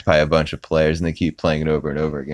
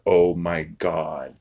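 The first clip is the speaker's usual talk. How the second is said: slow stunned oh my God; 4 dB quieter, much slower than usual. Retold or imitated. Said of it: "just like".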